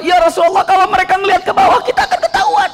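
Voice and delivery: fast, rising to a high cry on ketahuan; panicked Abu Bakar. Acted. Abu Bakar panicking in the cave to the Prophet, rising cry. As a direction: blurt the danger, voice jumping up at the end. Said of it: "Padahal Abu Bakar".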